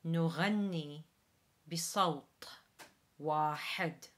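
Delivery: Arabic phrase, guttural GH; Arabic model. Shown as a demonstration. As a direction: say نغني with a French R. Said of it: "the final phrase in this verse is".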